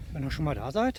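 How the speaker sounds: normal talking voice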